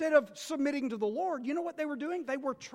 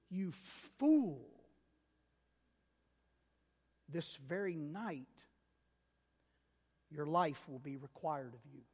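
voice of God; 6 semitones lower, 4 dB quieter than usual. Retold or imitated. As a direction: pronounce the verdict quietly and gravely. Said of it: "how Jesus ends the parable".

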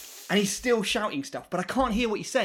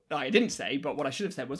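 ordinary talk, nothing marked

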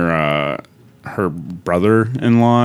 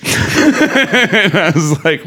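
laughing; 11 semitones higher, 3 dB louder, much slower than usual. Real, not acted. Laughs while telling.

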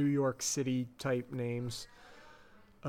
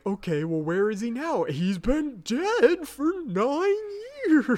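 mock Scully; 10 semitones higher, 6 dB louder than usual.

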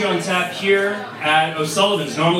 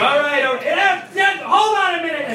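high, raised-voice brush-off; harried; 10 semitones higher, 4 dB louder than usual. Retold or imitated. Husband on the phone in an argument with his wife, harried high voice. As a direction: juggle a caller and a quarrel, flustered.

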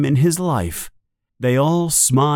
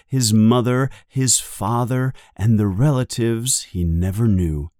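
usual speaking voice

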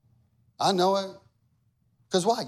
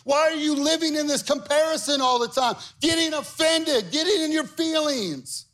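full-force preaching; 11 semitones higher, 4 dB louder than usual. Acted. Pastor confronting the church over judging, full-force preaching. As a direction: press the question home.

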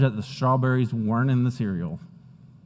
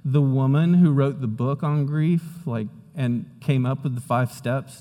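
normal talking voice

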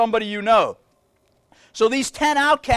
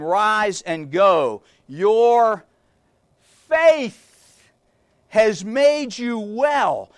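very slow, weighty delivery; preacher solemnly reading Jesus' blessing; slow dramatic reading; much slower than usual. Acted. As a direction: pause and lean on faith.

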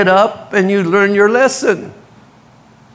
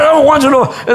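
angry shout; 8 semitones higher than usual. Retold or imitated. Larry yelling.

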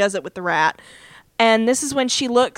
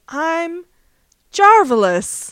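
woozy drawn-out boast, slowed on the last word; Jarvis; 6 semitones higher, 4 dB louder, much slower than usual. Retold or imitated. Drugged man answering if he's all right, slow imitated slur. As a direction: imitate a man about to collapse, stretching it out. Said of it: "And he goes".

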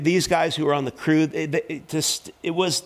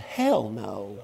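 low, mock-solemn refusal; deadpan, softened retort; blunt refusal; 6 semitones lower, 4 dB quieter than usual. Pastor answering the father's request, bluntly refusing.

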